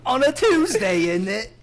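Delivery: high, loud laughing British voice; over-the-top English accent. Pretend. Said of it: "get a different accent".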